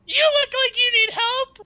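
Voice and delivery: very high, loud squeak; friendly but menacing squeak; Rupert's voice. Acted. Rupert offering help to a nurse, high squeak. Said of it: "Just gonna go".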